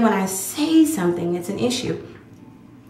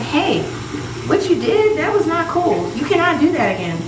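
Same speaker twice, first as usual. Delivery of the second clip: high, raised voice calling someone out; retold confrontation; 10 semitones higher than usual. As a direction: scold them sharply, pitch up. Confronting the person directly.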